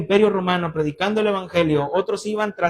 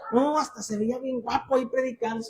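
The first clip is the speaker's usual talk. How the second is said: higher, softer imitated female admirer; admiring churchwoman; 5 semitones higher, 5 dB quieter than usual. Churchwoman complimenting the young preacher after his sermon, gushing. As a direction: gush lightly in a raised voice. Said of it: "viene otra hermana con".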